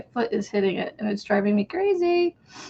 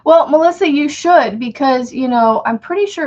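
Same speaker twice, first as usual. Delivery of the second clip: excited; 4 semitones higher, 7 dB louder than usual. Real, not acted. Excited reply to a viewer.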